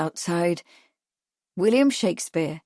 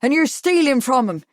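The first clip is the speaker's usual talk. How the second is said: raised, loud accusing cry; higher, louder outcry in protest; 6 semitones higher, 6 dB louder than usual. A narrator's reading. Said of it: "Harry cried".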